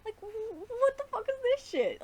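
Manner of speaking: high, loud cry of exasperation; real fan frustration at the writing; exasperated outburst. Real, not acted. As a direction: throw up your hands at it.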